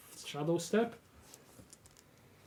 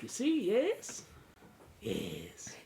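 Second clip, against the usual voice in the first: lizard folk voice; 3 semitones higher, 5 dB quieter, much slower than usual. Acted. GM voicing lizard merchant.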